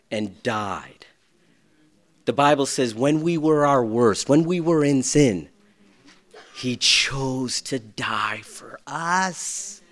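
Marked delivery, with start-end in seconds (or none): 0.1-1.1 soft whispered and died; hushed reverence for the death. Real, not acted. Solemn moment. 6.5-9.8 slow solemn. Real, not acted.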